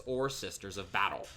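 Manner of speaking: ordinary talk, nothing marked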